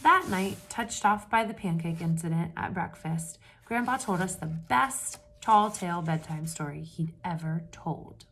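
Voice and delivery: steady read-aloud storytelling voice; story narration. A narrator's reading. Teacher reading a picture book to the class, storytelling voice.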